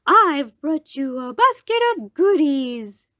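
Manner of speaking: put-on a wolf faking a little girl's voice. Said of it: "called the wolf in a little girl voice".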